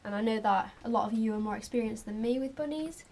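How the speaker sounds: ordinary talk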